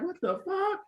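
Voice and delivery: shocked mother. Retold or imitated. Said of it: "my mother was like".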